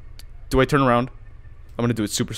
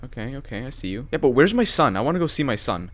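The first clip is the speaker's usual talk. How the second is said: normal talking voice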